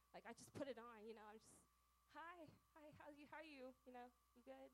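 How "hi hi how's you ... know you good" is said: high, bright put-on churchy hello; fake church greeting. Girl mimics her own fake smiley greeting at church. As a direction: chirp it like a forced happy greeting at church. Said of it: "that little thing you do at church".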